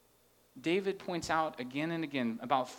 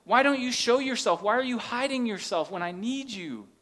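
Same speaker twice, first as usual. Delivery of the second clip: higher, louder desperate plea to God; voicing David's plea, higher and louder; pleading cry; 7 semitones higher, 7 dB louder than usual. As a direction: beg God to show himself. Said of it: "he's basically saying".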